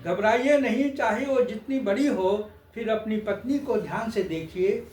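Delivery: lilting comic-poet recital; poem recitation. Acted. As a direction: build the verse towards a punchline.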